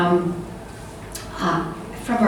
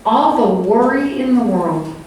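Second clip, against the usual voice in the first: ordinary talk, nothing marked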